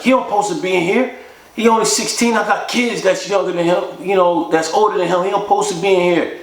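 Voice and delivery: put-on older inmate pleading with the guard, high and fast; protesting inmate.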